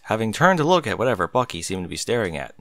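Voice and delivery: ordinary delivery, nothing marked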